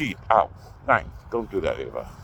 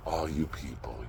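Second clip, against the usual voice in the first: quiet repeat; 8 semitones lower, 14 dB quieter than usual. Shown as a demonstration. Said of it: "So all you people".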